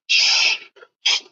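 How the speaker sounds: hissy slashing sound effect; imitated cutting noise. Soldier hearing rescuers hack through thicket, imitated cutting noise. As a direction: mimic blades through brush with sharp shushes. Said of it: "kinda, like, cutting and violent coming".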